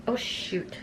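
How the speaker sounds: breathy whispered oh shoot; whispered oops. Real, not acted. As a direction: whisper it under your breath. A small mishap mid-application.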